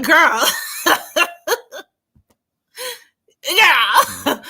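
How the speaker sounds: high, laughing through the word. Real, not acted. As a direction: crack up mid-story. Laughing at memory.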